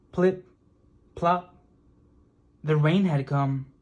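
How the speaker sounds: ordinary delivery, nothing marked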